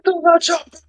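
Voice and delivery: put-on high Austrian-accent movie line; Arnie voice. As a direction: bark the line like Arnold. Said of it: "movie quote Arnold".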